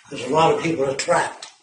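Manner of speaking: usual speaking voice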